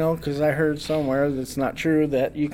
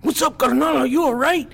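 high, loud concerned call; brother checking in; 8 semitones higher, 3 dB louder than usual. Retold or imitated. Brother bursting through the door, high loud worried call. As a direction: burst in asking urgently. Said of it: "he goes".

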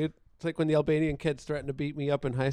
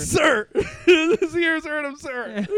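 mock crying; 10 semitones higher, 6 dB louder, much slower than usual. Acted crying plea quote.

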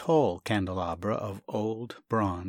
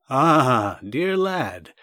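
hearty doctor's greeting; 3 semitones higher, 8 dB louder, much slower than usual. Acted. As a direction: greet the lad loudly and gladly. Doctor greets Sasha warmly.

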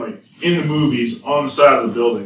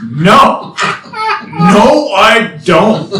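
firm refusal; 7 semitones higher, 5 dB louder, much slower than usual. Retold or imitated. Refusing the girl's offer.

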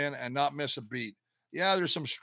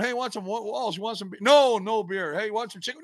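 voicing teammates higher, tempting him with food; teasing teammates; 8 semitones higher than usual. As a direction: dangle the food in front of him.

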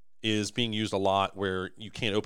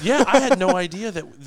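loud, high burst of amazement; amazed; 10 semitones higher, 11 dB louder than usual. Real, not acted. Cohost reacts to millions of parts, amazed. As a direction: blurt it out, much higher and louder. Excited amazement at inventory size.

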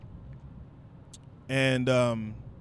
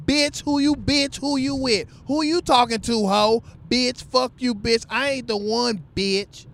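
higher, shrill-free mock catfight voice; mock girl fight; 7 semitones higher than usual. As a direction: play an angry girl mouthing off in a club. Women's fight acted quote.

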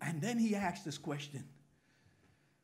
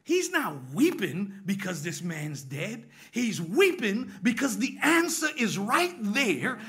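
slow emphatic preaching; 4 dB louder, slower than usual. Real, not acted.

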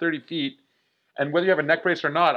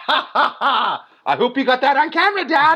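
high, giddy laugh then quick joke; 9 semitones higher, 4 dB louder than usual. Retold or imitated. Daughter joking to dad after crashing, high laughing. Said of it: "She says".

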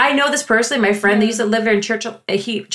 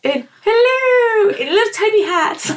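giggly, higher-pitched mock British; mock British accent; 6 semitones higher than usual. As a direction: put on a playful English accent, laughing through it. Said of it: "I like your British voice".

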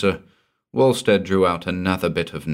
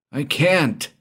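much higher, hysterical cry; 10 semitones higher than usual. Acted. Frightened rich man refusing to pay, hysterical cry. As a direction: blurt it in hysterics. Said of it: "exclaimed Walstead hysterically".

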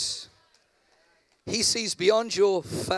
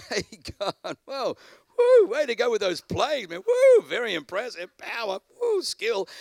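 higher, louder mock-cheering buddy; casual debrief with God; 4 semitones higher, 5 dB louder than usual. Preacher imagining praising God's plagues, mock cheering. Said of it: "debrief with God".

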